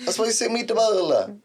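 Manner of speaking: high put-on Italian accent; mock Italian. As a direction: ham up the meatball line. Italian cartoon riff.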